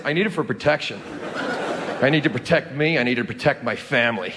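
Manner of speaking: put-on a light American accent on a gun owner; mock American accent. Comedian mocking American gun owners' excuse, in a light American accent. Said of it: "The, the switch up on the, the accent".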